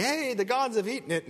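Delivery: high-pitched mock delight; excited exclamation. People find the food gone, mock excited cry. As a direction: imitate villagers' excitement, pitched well up. Villagers voiced, delighted at empty offering.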